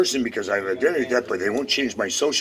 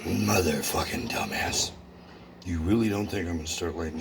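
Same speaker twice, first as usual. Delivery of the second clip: whispered curse; 8 semitones lower, 8 dB quieter than usual. Real, not acted. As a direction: hiss it to yourself. Curses under his breath.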